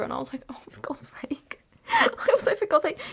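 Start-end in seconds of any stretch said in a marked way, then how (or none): 0.5-1.6 slow, breathless whispered groan; a defeated whispered oh for God's sake; whispered exasperation. Retold or imitated. Groaning at dad's cheaper man. 1.7-3.0 high, cracking, laugh-choked repeat; half-crying laughing for God's sake; laughing exasperation. Real, not acted.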